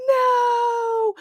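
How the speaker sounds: high, stretched-out retold yell. Both owners shouting at the humping dog, high yell. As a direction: wail it out to stop the dog. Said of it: "And we're yelling".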